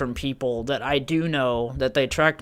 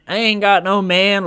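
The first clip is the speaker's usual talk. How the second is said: higher, louder put-on sassy woman; imitated caller's voice; 6 semitones higher, 5 dB louder than usual. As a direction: do an impression of the woman on the phone.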